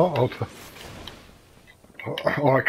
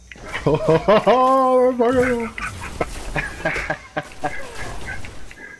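long high-pitched laughing fit; cracking up helplessly; hysterical laughter; 10 semitones higher than usual. Real, not acted. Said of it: "Too funny".

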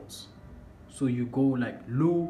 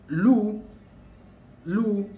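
"Lu" called out much higher and louder; stressed syllable; 9 semitones higher, 6 dB louder than usual. Shown as a demonstration. Teacher pointing learners back to the syllable Lu, stressed. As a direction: punch out "Lu" loudly, leaning on the U. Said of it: "has a vowel U".

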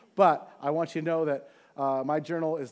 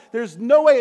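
a sudden loud protest to God; loud, hopeless outburst; loud despairing cry; 4 semitones higher, 11 dB louder than usual. Retold or imitated. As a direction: throw it out in despair. Despairing prayer peak.

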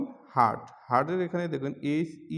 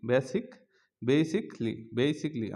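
ordinary delivery

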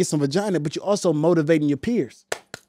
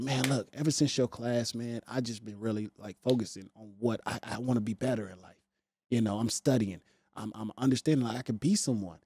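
much softer, earnest confession; hushed, sincere young man opening up; soft earnest student; 7 semitones lower, 13 dB quieter than usual. Retold or imitated. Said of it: "He's like".

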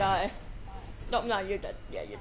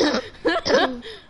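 coughing laugh; 3 semitones higher, 4 dB louder than usual. Real, not acted. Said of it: "sounds like me sometimes when I laugh".

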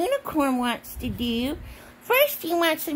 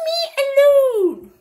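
mock protest; 10 semitones higher, 8 dB louder than usual. Imitating what she said, high loud mock protest. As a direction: wail it loudly, half laughing. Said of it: "She said".

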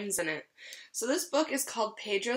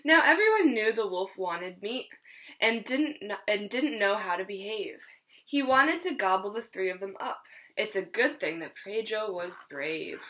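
lively storybook read-aloud; reading to children. Acted. Teacher reading a rhyming story to her class, lively. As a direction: read it like a picture book for a class. Reads picture book to children.

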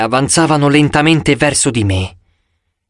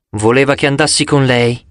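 ordinary delivery

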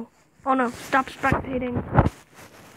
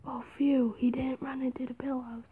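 whispering; 8 dB quieter than usual. Real, not acted. Relieved whisper.

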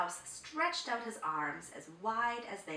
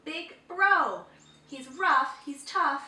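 1 loud insisting; 6 semitones higher, 10 dB louder, slower than usual. A narrator's reading. Chimpanzee insisting.

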